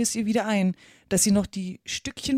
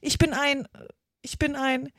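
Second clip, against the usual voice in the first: higher, hesitant false starts; start, stop, try once more; faltering; 6 semitones higher than usual. A narrator's reading. Alice unsure what she is, faltering.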